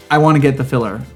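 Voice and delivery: ordinary talk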